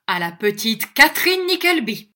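loud, high-pitched insolent toast; disrespectful toast. A narrator's reading. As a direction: call out a brash toast, far from respectful. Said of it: "le ton dont ces mots avaient été prononcés était loin d'être respectueux".

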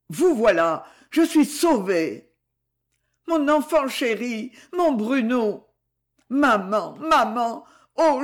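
lightly voiced dying son clinging to his mother; feverish dying son. A narrator's reading.

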